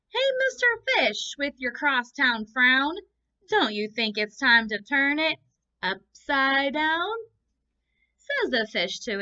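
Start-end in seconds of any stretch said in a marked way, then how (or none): 0.1-7.1 bright, higher and louder friendly clam; cheerful clam. Acted. Clam cheerily advising the gloomy fish, higher and louder. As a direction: chirp it like a sunny clam.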